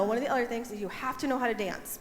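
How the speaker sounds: ordinary talk, nothing marked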